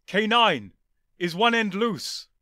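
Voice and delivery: loud, high urgent call; urgent shout. Acted. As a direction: shout to the robot. The Doctor calling to K9.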